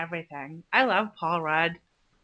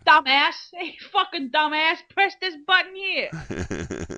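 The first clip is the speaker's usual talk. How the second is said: mock rude tutorial; 6 semitones higher than usual. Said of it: "It's just".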